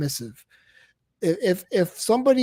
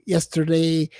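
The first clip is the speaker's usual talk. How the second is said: normal talking voice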